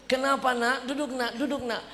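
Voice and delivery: a light doting mother voice. Retold or imitated. Mother soothing her son.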